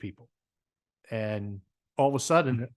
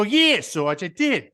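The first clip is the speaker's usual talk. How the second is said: loud, high Jersey private answering back; mock Jersey reply to a sergeant; Jersey accent; 7 semitones higher, 6 dB louder, slower than usual. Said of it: "I'm from Jersey. That's the way we speak".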